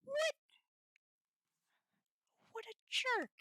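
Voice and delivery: quick indignant outburst; outraged. Real, not acted. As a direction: snap it out. Repeated outraged exclamation.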